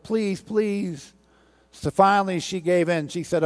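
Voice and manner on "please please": higher, pleading please. Acted. Council members begging the teacher to return, pleading. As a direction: beg her to come back.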